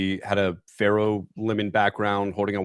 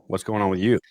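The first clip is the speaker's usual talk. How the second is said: normal talking voice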